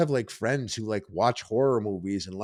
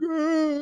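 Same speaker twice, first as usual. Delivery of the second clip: much higher startled gasp; high panicked gasp. Retold or imitated. Girl hears them laughing and gasps high in panic.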